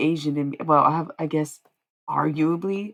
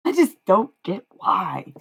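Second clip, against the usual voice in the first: louder, higher, slow "I just don't get why"; loud exasperation; 5 semitones higher, 5 dB louder, slower than usual. Real, not acted. Streamer venting at critics who hate her, loud and slow. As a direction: lean hard on "just", drawn out and frustrated.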